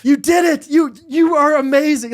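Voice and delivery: high, loud, rising joy; elated praise. Retold or imitated. Husband praising wife after birth, high and loud. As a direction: burst with pride at her. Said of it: "and, like".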